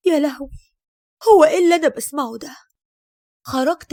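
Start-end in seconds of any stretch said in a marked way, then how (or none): 0.1-2.6 shocked outcry. Acted. Shocked by son's words.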